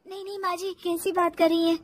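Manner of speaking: high, very soft denial; meek daughter-in-law. Acted. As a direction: back down timidly. Meek denial to angry mother-in-law.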